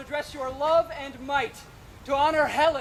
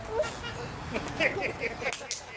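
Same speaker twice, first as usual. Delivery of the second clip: high, airy mischievous giggle; soft high sprite's giggle; impish laugh; 10 semitones higher, 7 dB quieter than usual. Acted. Puck exits after charming eyes.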